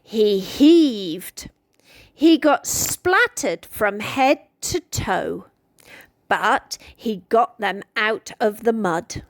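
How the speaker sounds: ordinary delivery, nothing marked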